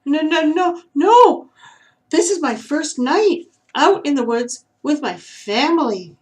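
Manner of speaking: put-on high, loud, squeaky young voice; frightened young squirrel squeaking to the owl; squeaking little squirrel. A narrator's reading. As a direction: squeak it out nervously, leaning on family. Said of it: "squeaked Shelby".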